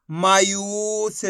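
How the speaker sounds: मायूस read very slowly, यू held long; drawn-out long ू. Shown as a demonstration. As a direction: stretch the "oo" in मायूस. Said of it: "खींच के पढ़ेंगे".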